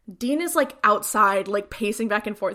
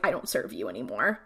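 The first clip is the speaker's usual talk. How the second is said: much lower, softer imitation of Cass; stern quoted refusal; 11 semitones lower, 6 dB quieter than usual. Cass back from heaven to Dean, low stern imitation.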